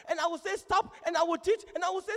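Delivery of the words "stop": high, acted order to stop; teacher's command. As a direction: call it out like a teacher halting the class.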